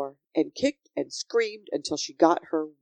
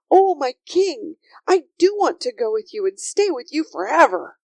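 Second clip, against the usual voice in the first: put-on higher, louder, fervent little girl; adoring Sophie; 7 semitones higher, 4 dB louder than usual. Sophie accepts life at the palace, fervent and adoring. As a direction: pour out wholehearted devotion.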